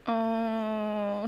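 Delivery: ordinary delivery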